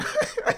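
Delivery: short, high-pitched burst of laughter; real laugh. Real, not acted. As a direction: break up briefly at the silliness. Laughs at the stupid bit.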